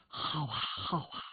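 choked, quiet, crying words; an old widow sobbing out her approval; tearful old lady. Acted. Widow answers her disciples through tears, choked sob. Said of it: "朱老夫人流着眼泪跟卢方位置说".

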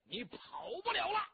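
high, loud call after the fleeing rider; shouting while chasing. Acted. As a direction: yell it after him at full gallop. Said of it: "一边跑一边喊".

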